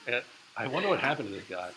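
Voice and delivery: ordinary talk, nothing marked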